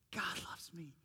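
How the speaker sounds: whisper. Acted. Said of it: "whispered realization".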